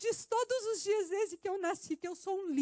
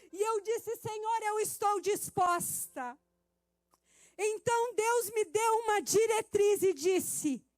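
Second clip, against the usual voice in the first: preaching at full force; 8 dB louder, slower than usual. Acted.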